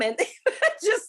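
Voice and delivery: high laughing burst; real giggle mid-sentence. Real, not acted. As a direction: laugh through the words. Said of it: "laughing at herself".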